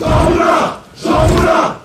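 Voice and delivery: high, loud chanted name; crowd acclamation. Acted. Rebels acclaim a newly named brother, high shout. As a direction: shout the name back in unison.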